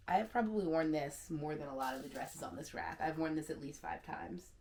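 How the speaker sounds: ordinary talk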